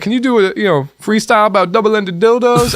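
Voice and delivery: high, exaggerated put-on voice of a prank caller. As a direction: ham up a fake street voice.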